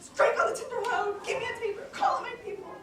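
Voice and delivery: a father bellowing into the house for his people; alarmed shout. Acted. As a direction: yell for light and help in a panic. Alarmed father calls for servants.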